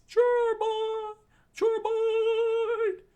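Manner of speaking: very high, very loud shriek, second cry stretched; screamed call. Acted. Sanji to chore boy mid-battle, screaming. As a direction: scream the nickname out long.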